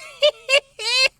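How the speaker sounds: high squeaky jeering laugh; mocking rat cackle. A narrator's reading. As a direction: cackle at her, high and nasty. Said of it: "mocking imitation".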